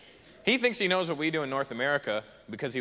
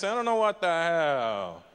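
mock Italian; slower than usual. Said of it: "In Italian, they always doing this".

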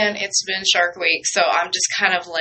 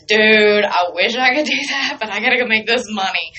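mock complaint to a friend; 2 semitones higher, 4 dB louder than usual. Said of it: "and I'm like".

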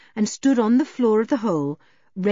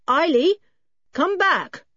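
louder, higher call into the tunnel; 11 semitones higher, 5 dB louder than usual. Acted. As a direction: shout after the vanished girl. Julian calls into dark tunnel.

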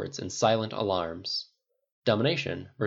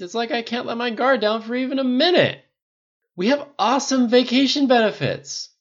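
put-on high, loud drunken venting; exasperated rant; 9 dB louder than usual. A narrator's reading. Friends venting about work over scotch, exasperated shouting. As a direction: protest at full voice.

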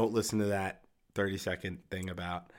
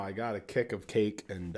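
normal talking voice

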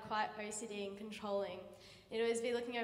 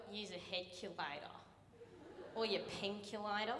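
lower, soft and lazy; lazy teacher voice; 3 semitones lower, 5 dB quieter than usual. Retold or imitated. Lazy teacher to student without calculator, low and lazy. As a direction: toss it off without caring.

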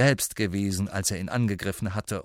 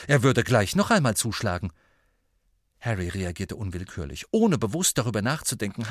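ordinary delivery, nothing marked

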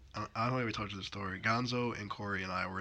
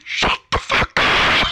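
loud, slow, raw scream; furious driver screaming; shout as loud as he can; 17 dB louder, much slower than usual. Retold or imitated. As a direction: scream it at the singer. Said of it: "Just as loud as he can".

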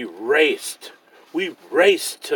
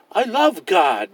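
proudly; 7 semitones higher, 4 dB louder than usual. A narrator's reading. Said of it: "little cub said proudly".

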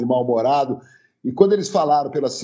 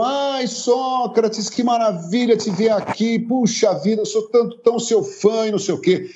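gushing fan; 10 semitones higher than usual. Retold or imitated. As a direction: fawn over a celebrity. Fawning fan greeting Sócrates.

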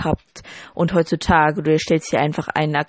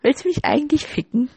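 mock outrage; 10 semitones higher than usual. Mock outrage at partner's remark.

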